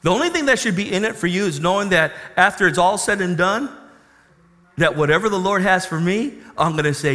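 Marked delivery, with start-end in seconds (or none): none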